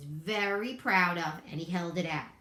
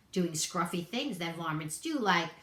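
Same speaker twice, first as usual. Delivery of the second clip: ordinary delivery, nothing marked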